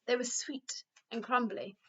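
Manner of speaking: ordinary delivery, nothing marked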